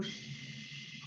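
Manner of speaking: soft unvoiced shush to a baby; soothing shush. Shown as a demonstration. Shushing a baby to soothe it. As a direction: hush a little baby gently. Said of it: "you go".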